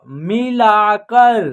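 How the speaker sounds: very slow, each syllable apart; slow clear word demo. Shown as a demonstration. Teacher modelling a new word for children, very slow. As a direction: drag the word out for beginners. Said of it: "मी".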